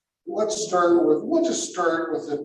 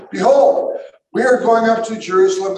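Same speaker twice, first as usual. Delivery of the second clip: loud, raised-pitch scripture proclamation; Jesus proclaiming; 6 semitones higher, 8 dB louder than usual. Acted. Jesus telling followers of the trip to Jerusalem, proclaiming. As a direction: announce it like Jesus to his followers. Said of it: "this is what Jesus says".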